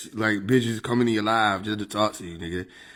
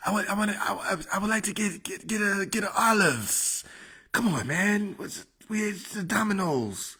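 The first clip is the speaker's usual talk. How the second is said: higher, softer, stammering politician; Joe Biden; 7 semitones higher, 4 dB quieter than usual. Acted. Comedian as the president fumbling a pizza order, Biden impression. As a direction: stumble and repeat, stretching the last words. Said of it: "who the fuck is a Joe Biden?".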